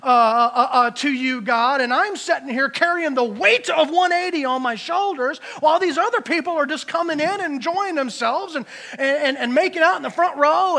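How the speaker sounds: loud, heated complaining to God; mock self-righteous outburst at God; acted angry rant. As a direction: vent like a fed-up, resentful believer. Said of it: "how quickly we go from praising the Lord to anger".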